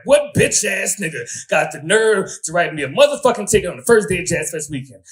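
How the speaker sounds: high loud furious yell; angry outburst. Retold or imitated. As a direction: erupt at the ticket. Furious at parking ticket.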